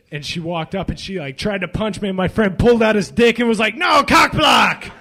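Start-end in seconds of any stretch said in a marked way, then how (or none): 3.7-4.9 high, loud mock yell, laughing through it; a laughing hammed-up yell of protest; retold shout. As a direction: bellow it like a guard blocking her. Friend yells at the girl.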